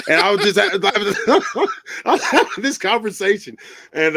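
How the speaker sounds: ordinary delivery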